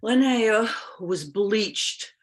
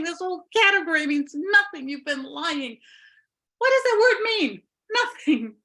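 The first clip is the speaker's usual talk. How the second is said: exasperated accusing; 6 semitones higher, 4 dB louder than usual. Acted. Linnea accuses the witch.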